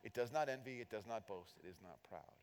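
부정어 not을 강조; stress on not. Shown as a demonstration. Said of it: "Notice the comparison language here".

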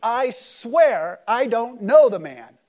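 loud, high, drawn-out angry shout; slow, emphatic outburst at an accuser; angry denial. Retold or imitated. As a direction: blast out a furious denial, every word stretched. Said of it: "he cusses her out".

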